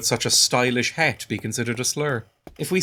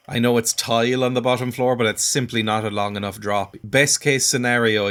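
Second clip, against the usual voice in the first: ordinary talk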